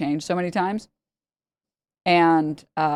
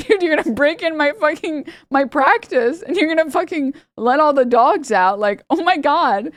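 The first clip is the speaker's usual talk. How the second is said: high, incredulous mock rant; acted indignation, pitched way up; retold exasperation; 9 semitones higher than usual. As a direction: imitate someone outraged at a break-in. Vet's retold outrage at break-in.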